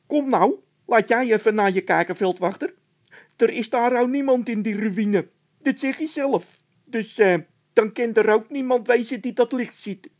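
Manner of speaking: very high, loud scared voice; a nervous squeaky henchman; squeaky frightened De Wit. A narrator's reading. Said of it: "De Wit riep uit". (De Wit, piepend hoog en bang)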